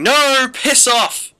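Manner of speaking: drawn-out, high, loud yell; screaming inner voice. Retold or imitated. Tired student inwardly refusing a religious chat, screaming. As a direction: scream it in exasperated refusal, dragging out the words. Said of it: "my brain was screaming".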